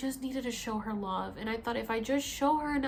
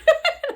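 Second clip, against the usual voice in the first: high, loud burst of laughter; cracking-up laugh; 13 dB louder than usual. Retold or imitated. Reliving the fall to the ground, high cracking-up laugh. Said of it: "I'm, like, cracking up".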